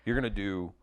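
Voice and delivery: ordinary delivery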